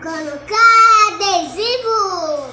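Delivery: excited shout. Real, not acted. Child answering excitedly.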